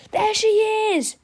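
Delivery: excited cry. A narrator's reading. Boy spots teacher's car.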